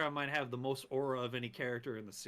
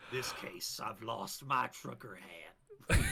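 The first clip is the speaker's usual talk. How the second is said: slow, lower-pitched Southern drawl; 4 semitones lower, slower than usual. Retold or imitated. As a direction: drag the words out like a mock Southern detective. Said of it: "sounding like some southern drawl".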